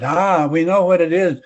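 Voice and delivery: a hint of a Russian scientist; Russian accent. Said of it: "your efforts to, uh, do a Russian accent are duly noted".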